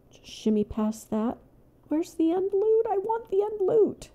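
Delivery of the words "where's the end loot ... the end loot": high-pitched eager wanting; begging the game for loot; greedy whining. Real, not acted.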